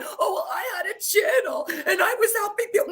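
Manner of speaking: high, whiny fake sob, mocking her; mock crying. Mocking a rival's tearful channel story, mock sobbing. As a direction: ham up a tearful complaint to ridicule it. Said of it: "don't cry up there going".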